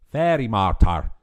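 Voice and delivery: loud, mangled mock-Scottish line; badly faked Scottish accent; mangled movie accent. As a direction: mimic a bad Scottish accent, loudly. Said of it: "like a woman says ... like that".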